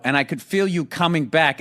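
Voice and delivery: ordinary talk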